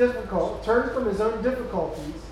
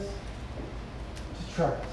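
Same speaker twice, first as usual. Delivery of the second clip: 1 whispered answer; 6 semitones lower, 12 dB quieter than usual. Real, not acted. Quiet punchline after question.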